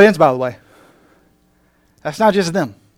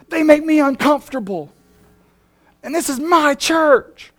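high, loud, slow whiny outrage; mock the offended churchgoer, loud and high; indignant shout; 10 semitones higher, 9 dB louder than usual. Preacher mocking a petty churchgoer, indignant loud shout.

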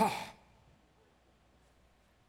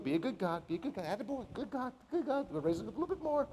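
praising a dog; 3 semitones higher, 6 dB quieter, faster than usual. Pretend. Mocking someone praising a pet.

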